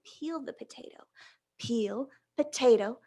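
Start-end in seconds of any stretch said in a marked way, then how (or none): none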